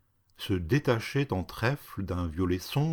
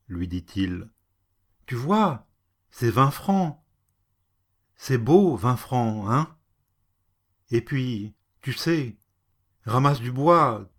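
almost pleading; 3 semitones higher, 4 dB louder than usual. Acted. Frightened cat bribing the woman, pleading. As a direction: soothe her with the gold coin. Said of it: "presque suppliant".

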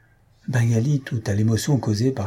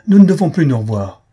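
much louder, higher cry; desperate cry; 5 semitones higher, 10 dB louder than usual. A narrator's reading. A heartbroken woman crying out to send her lover away. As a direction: push him away with the words. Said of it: "cria-t-elle".

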